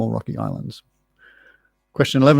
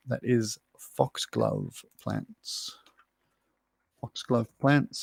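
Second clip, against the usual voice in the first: hushed; 8 dB quieter, much slower than usual. Real, not acted. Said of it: "drops to near whisper".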